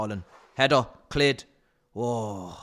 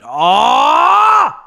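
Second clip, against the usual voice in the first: loud rising shout of dismay; anguished shout; 10 semitones higher, 13 dB louder than usual. Real, not acted. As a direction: yell as the tackle goes in. Player dives in box.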